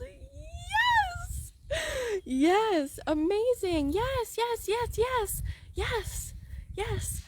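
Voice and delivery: high, delighted string of yeses; excited yes. Shown as a demonstration. Coach cheering on students' imagined desires, thrilled.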